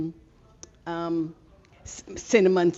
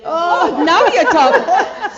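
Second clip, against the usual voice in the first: loud, laughing approval; laughing with delight; 9 semitones higher, 10 dB louder, slower than usual. Real, not acted. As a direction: cheer the idea on.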